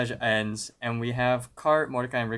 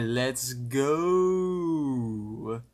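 long drawn-out, swelling "go"; retold rallying call; 3 semitones higher than usual. Rigby urging Mordecai toward the sunset, playfully rallying. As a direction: stretch the last word out in mock excitement.

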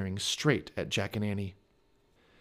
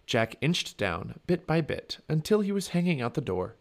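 plain reading voice

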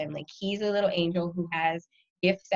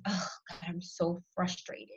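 very serious; 9 dB quieter than usual. Retold or imitated. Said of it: "turns to me very serious".

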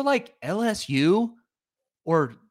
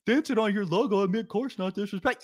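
mock whiny denial; 8 semitones higher than usual. Host mimics Auburn fans denying disrespect, high whine. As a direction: whine it like a fan denying guilt. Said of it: "then be like".

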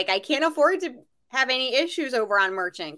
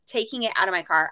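ordinary delivery

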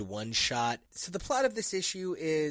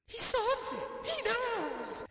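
much higher, slow, hammed-up awe; mock-dramatic reveal; slower than usual. Podcaster hamming up Ghost Rider's big realization, high and slow. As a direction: play the eureka moment big and silly. Mock-epic recap of a comic scene.

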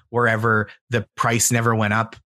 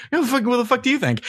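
high and fast, mock-outraged kid; bratty kid snapping back; 11 semitones higher, faster than usual. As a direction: rattle it off like an offended teen. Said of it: "and he goes".

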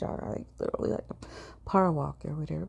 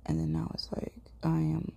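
ordinary talk

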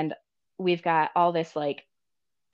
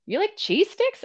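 much higher, lightly voiced puzzled sister. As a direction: ask it like a baffled big sister. Voicing sister's puzzled question.

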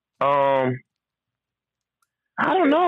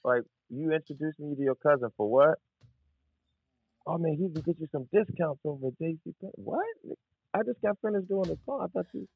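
quiet mock disbelief; 5 semitones higher, 9 dB quieter than usual. Mock incredulous, softer.

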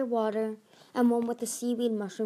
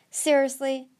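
louder, scoffing disbelief; 4 semitones higher, 5 dB louder than usual. A narrator's reading. Glory answers Tsunami's doubt, scoffing. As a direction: scoff at the question, pitched up. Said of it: "Glory scoffed".

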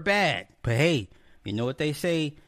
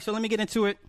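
ordinary talk, nothing marked

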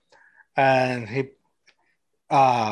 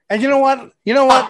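excited buildup; 9 semitones higher, 6 dB louder than usual. Real, not acted. Announcing a big reveal.

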